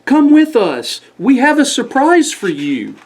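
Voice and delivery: high, excited squirrel voices; cheery neighbors. Acted. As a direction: coax him along with a secret.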